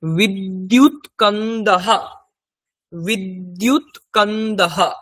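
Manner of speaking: বিদ্যুৎ কন্দাহা slowly as vid-dyut kan-da-ha; slow model pronunciation. Shown as a demonstration. Teacher gives learners the Sanskrit for bulb, slow বিদ্যুৎ কন্দাহা. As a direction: separate each syllable of বিদ্যুৎ কন্দাহা, holding the n. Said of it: "তার সংস্কৃত কি হবে?".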